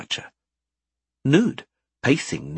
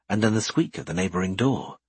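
ordinary delivery, nothing marked

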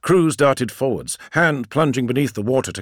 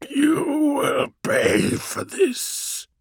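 high, slow, snarling demon threat; much slower than usual. Acted. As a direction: hiss a wounded monster's vow.

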